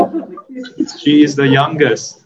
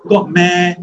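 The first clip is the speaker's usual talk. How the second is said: "God meh." punchy and higher, Singapore style; Singlish; 6 semitones higher, 5 dB louder than usual. Shown as a demonstration. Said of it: "You're supposed to say".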